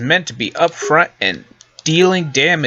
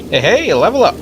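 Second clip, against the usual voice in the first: excited; 8 semitones higher, 4 dB louder than usual. Real, not acted. Celebrating a level up.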